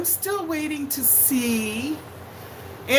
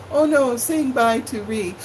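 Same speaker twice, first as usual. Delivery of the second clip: ordinary delivery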